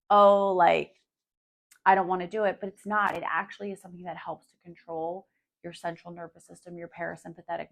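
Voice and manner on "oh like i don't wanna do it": louder, reluctant sceptic's brush-off; mock reluctant whine. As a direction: play the doubter who won't bother. Sceptic brushing off breath work.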